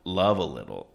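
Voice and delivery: ordinary talk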